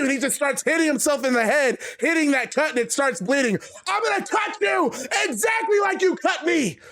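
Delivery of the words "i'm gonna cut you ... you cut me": high, yelled bloody threat; furious shout. Acted. Bleeding Seth yells his revenge at Damien, furious. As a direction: threaten him at full yell.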